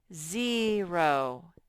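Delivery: "Zero" with a long, loud ZEE; syllable modelling. Shown as a demonstration. Teacher showing children the first syllable, stretched syllable modelling. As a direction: draw out both syllables of "Zero". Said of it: "First syllable, Z".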